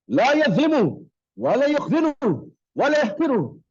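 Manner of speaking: loud, high Arabic declamation; ringing hadith quotation, phrase by phrase; forceful recitation. Shown as a demonstration. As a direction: proclaim each phrase with a big rise in pitch.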